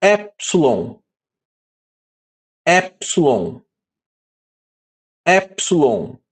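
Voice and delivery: Alfa said slowly three times, each with a falling tune; careful repetition. Shown as a demonstration. Teacher modelling the Greek letter names for the class. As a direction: model each syllable of Alfa for students to copy. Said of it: "Eu vou falar três vezes".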